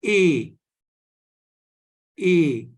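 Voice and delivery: A as a pure French "ay"; accented E sound. Shown as a demonstration. Teacher models accented E for students, A as French é. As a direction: say A loud and clear, falling, as French é. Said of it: "it will be pronounced as".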